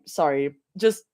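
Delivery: ordinary talk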